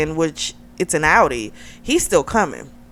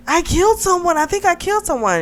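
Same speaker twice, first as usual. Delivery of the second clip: high-pitched, shaken confession; mock-distraught, high voice; acted distress; 11 semitones higher, faster than usual. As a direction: imitate someone in shock, voice pitched way up. Shaken woman confessing after the crash.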